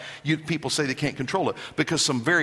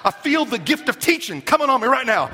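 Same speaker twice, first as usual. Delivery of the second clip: mock frenzy; 6 semitones higher, 3 dB louder than usual. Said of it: "all of a sudden you go".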